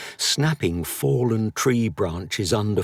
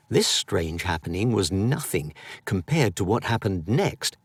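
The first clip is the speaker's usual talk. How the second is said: ordinary delivery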